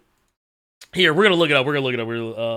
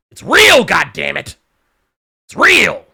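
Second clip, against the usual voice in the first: mock outraged insistence; 7 dB louder than usual. Host insisting the wrestling faction is real, mock outrage. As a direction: shout it like a die-hard believer.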